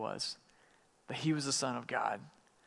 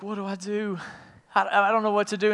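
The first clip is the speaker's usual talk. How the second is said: flustered panic; 6 semitones higher, 5 dB louder than usual. Retold or imitated. Pilate panicking over crowd's choice.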